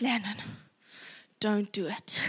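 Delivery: low, mock-stern warning; a quiet parental warning. Mom warning son not to throw, mock-stern. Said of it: "And I said".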